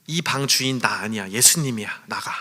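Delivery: ordinary talk, nothing marked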